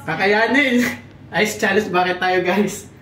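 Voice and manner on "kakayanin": ordinary delivery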